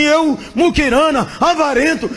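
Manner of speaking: high, loud self-accusation; ecstatic confession. Retold or imitated. Friend calling himself a miser, high loud confession. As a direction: cry out your own stinginess. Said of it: "E o outro disse assim".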